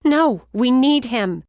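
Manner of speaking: loud, slow insistent protest; arguing. Acted. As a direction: refuse to leave him. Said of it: "she argued".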